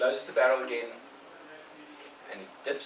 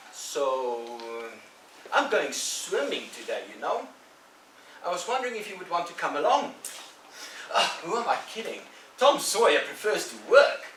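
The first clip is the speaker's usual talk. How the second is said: put-on higher, louder cocky boy voice; teasing bully Ben; 6 semitones higher, 6 dB louder than usual. Ben taunting Tom about missing swimming, smug and loud. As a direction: needle him with a smug grin.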